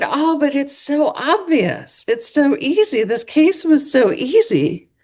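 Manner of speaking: high, slow airy brush-off; smug students. Retold or imitated. Students telling the teacher the case was easy, breezy and smug. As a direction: make it sound too easy, sing-song.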